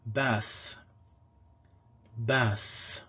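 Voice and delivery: Bath said with a clear final th; fricative th. Shown as a demonstration. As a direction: end Bath on a soft th. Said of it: "and at the end".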